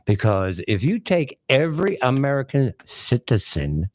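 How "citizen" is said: "citizen" set apart and deliberate; stress on citizen. Real, not acted. Commentator making a point to the President, stressing "citizen". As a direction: lean on the word "citizen". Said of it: "I stress the word citizen".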